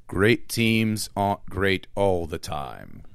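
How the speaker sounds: low, slow, grave announcer read; deep, slow epic narration; dramatic narrator voice. Retold or imitated. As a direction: intone it like a film narrator. Quoting famous narrator line.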